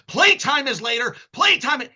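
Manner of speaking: high and loud mock reprimand; mock stern scolding. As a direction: tell the cat off like a strict parent. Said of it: "this is verbatim, say this".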